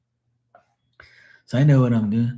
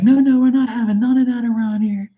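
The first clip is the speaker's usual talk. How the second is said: high mock woman scolding; imitating the lady; 13 semitones higher, 3 dB louder than usual. Lady stopping the fight outside, in a high mock scold. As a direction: pitch it up like the fussy lady. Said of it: "And the lady was like".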